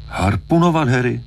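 high-pitched disbelief on HARPUNOVAT; incredulous echo. A narrator's reading. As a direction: throw HARPUNOVAT back as a startled, rising question.

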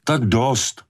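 high, abrupt order to stop; commanding yet pleading outburst; cried-out command. A narrator's reading. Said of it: "vykřikl Brody".

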